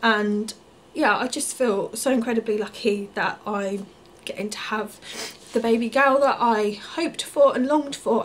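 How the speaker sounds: ordinary talk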